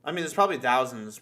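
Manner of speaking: normal talking voice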